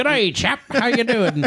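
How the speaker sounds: high-pitched joke Irish-Aussie greeting; botched Irish accent. Acted. Co-host clowning as nineteen twenty-five chap, botched Irish accent. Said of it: "in an Irish accent".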